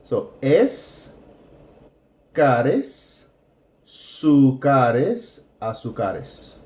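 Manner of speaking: azúcares built from its end: es, cares, sucares; building the word. Shown as a demonstration. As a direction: assemble azúcares syllable by syllable backward. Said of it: "building up the word".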